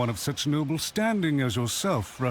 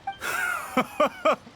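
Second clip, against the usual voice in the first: a sharp, high mocking laugh; scornful high laugh. Cornered official laughs scornfully at the commissioner. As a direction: laugh off the accusation with contempt. Villain mocked, laughs back.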